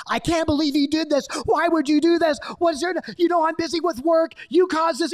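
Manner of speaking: high-pitched, exasperated parental rant; mock angry scolding. Parent blaming child for spilled drink, high angry scolding. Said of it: "now you're screaming at them".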